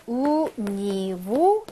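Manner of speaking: slow, spaced-out syllables; teacher's drawn-out example; slow modelling. Shown as a demonstration. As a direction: stretch each syllable for learners.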